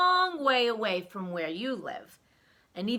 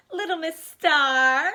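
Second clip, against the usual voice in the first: proud; 5 semitones higher, 9 dB louder, slower than usual. A narrator's reading. Character states her name.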